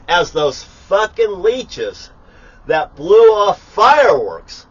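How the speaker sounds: slow, loud, furious rant; angry rant. Real, not acted. Ranting at the fireworks neighbours, slow furious yell.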